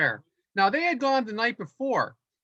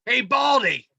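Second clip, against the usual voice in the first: 1 mock heckling clown; 4 semitones higher, 5 dB louder than usual. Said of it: "but I guess it's me".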